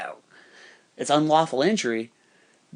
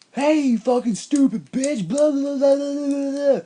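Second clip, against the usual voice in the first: high, loud imitation of an abusive guy; hammed-up shouted ranting; mock angry tirade; 12 semitones higher, 5 dB louder than usual. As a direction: yell it mockingly, dissolving into babble. Acting out angry man's insults.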